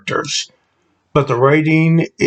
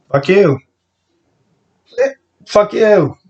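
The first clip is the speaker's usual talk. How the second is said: higher, put-on cheery greeting voice; mock street greeting; 8 semitones higher than usual. Retelling greeting strangers in China, mock cheery hello. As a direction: toss it off like a friendly hello. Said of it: "go down and said".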